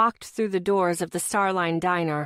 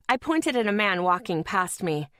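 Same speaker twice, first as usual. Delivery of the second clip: ordinary delivery, nothing marked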